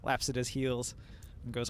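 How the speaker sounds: normal talking voice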